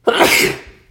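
sudden loud sneeze; one sharp real sneeze, then carry on. Real, not acted. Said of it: "That was a sneeze".

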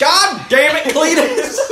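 hammed-up hollering through laughter. Acting out yelling at Cletus.

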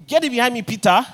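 loud, firm command to Peter; Jesus sharply rebuking Peter; stern rebuke. Retold or imitated. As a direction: cast him off with force.

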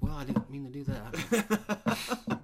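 very slow, sheepish and laughing. Retold or imitated. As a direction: drag it out apologetically. Acted sheepish time traveler.

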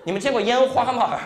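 ordinary talk, nothing marked

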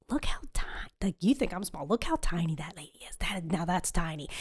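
soft, half-whispered gossip; low, hushed secretive remark; imitated furtive aside. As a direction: whisper it so she won't overhear. Furtive remark about a passer-by.

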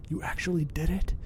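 hushed bellow. Acted. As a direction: exclaim in shock but keep it down. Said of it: "he bellowed as quietly as he could".

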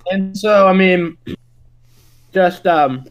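chewing ice while talking; mouth full. Real, not acted. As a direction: talk around a mouthful of ice. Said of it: "sorry, I'm chewing ice".

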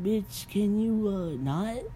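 high, slow, fed-up put-down; exasperated retort. Retold or imitated. Viewer fed up with a character, exasperated high retort. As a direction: drag it out like you're done with her.

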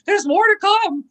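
high, loud imitated alarm; mock concern. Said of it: "He's, like, very concerned now".

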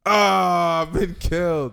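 loud, high mock dying cry; mock death cry. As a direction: cry out as if struck down.

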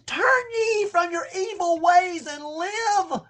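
slow, high, tearful pleading; tearful plea. Acted. Preacher voicing God begging Israel to turn, tearful slow plea. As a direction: plead through tears. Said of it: "But he says".

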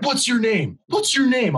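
high, insistent mock demand; imitating angry demand. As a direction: hammer the repeated question like an irate customer. Customer repeating his demand, acted out.

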